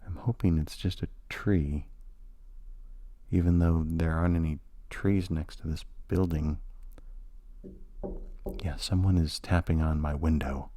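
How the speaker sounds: hushed unease. Acted. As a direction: speak softly, as if listening for the tapping. Alone at night, tapping at window.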